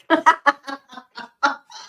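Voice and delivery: loud, high giggling laugh; laughter bubbling out in a long run; real belly laugh. Real, not acted. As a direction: crack up at your own joke's punchline. Laughs at her joke's punchline.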